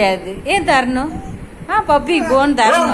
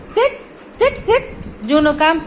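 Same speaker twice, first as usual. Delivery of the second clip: short rising orders, pitched well up; dog command. Real, not acted.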